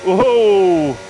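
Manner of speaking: loud, high burst of excitement; excited whoa. Real, not acted. Streamer watching a rival's fast lap, excited shout. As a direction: cry out as the lap lands.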